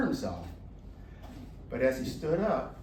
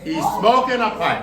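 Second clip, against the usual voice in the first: laughing repeat; 6 semitones higher, 13 dB louder than usual. Real, not acted.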